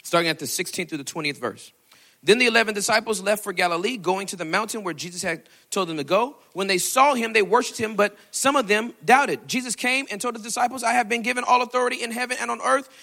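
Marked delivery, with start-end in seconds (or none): 2.2-12.9 scripture reading. Acted. Reads scripture in preaching voice.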